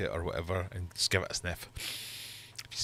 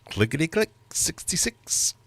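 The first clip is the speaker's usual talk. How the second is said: bingo caller; 7 semitones higher, 6 dB louder than usual. Acted. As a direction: sing out the bingo call. Bingo rhyme call.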